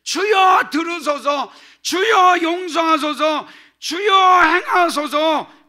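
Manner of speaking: each Lord shouted high and loud; triple cry of Lord. Shown as a demonstration. Pastor demonstrates the threefold cry of Lord, shouting. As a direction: cry out three times to God. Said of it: "어떨 때?".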